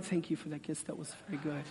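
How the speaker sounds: mock-dry murmured thanks; prim polite thanks. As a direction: thank them like a dull business deal. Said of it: "were you like".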